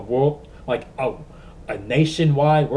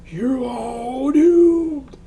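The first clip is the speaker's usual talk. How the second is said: very slow, much higher shouted doom; ominous shout; 13 semitones higher, 6 dB louder, much slower than usual. Retold or imitated. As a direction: yell the warning like a madman. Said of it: "has to be Crazy Ralph".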